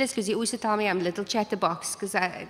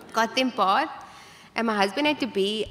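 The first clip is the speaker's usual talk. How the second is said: normal talking voice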